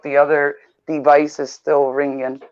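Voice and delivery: normal talking voice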